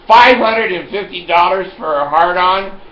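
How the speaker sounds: high, loud comic disbelief; loud incredulity. Retold or imitated. Customer questioning the pharmacist's price, loudly incredulous. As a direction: balk at the price, full voice.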